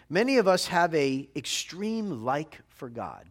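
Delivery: ordinary delivery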